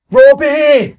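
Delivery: high, loud imitated shout for help; desperate call. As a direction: call to the manager in mock desperation. Acting out Cal calling from pitch.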